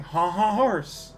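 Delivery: mock French laugh. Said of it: "the French laugh".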